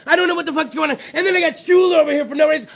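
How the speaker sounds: ordinary talk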